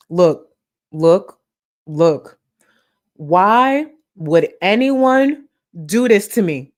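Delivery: loud high mock wail; mock outrage. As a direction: wail it like a wronged diva.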